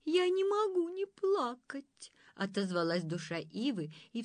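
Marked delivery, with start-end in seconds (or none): 0.1-2.1 high, slow tearful voice; weepy willow. A narrator's reading. Willow soul explaining her tears, tearful. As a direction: whimper it out like a willow. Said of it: "плакучая".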